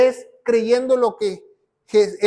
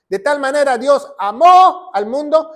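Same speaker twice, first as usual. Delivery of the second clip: "amó" punched louder on its final O; stress on AMÓ; 4 semitones higher, 7 dB louder than usual. Shown as a demonstration. Preacher repeating the verse to show past tense, "amó" stressed on the O. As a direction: lift the voice and lean on the O of "amó". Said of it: "con acento en la O".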